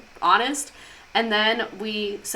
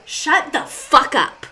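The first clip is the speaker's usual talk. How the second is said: slow, higher, loud and emphatic; exasperated outburst; 5 semitones higher, 5 dB louder, much slower than usual. Real, not acted. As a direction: punch each word in exasperation.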